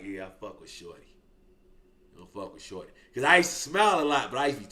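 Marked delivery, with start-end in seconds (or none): 0.0-1.0 low, quiet mock of Big Vinny; Big Vinny's voice. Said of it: "Big Vinny like". 2.1-2.9 low, quiet mock of Big Vinny; Big Vinny's voice. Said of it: "Big Vinny like".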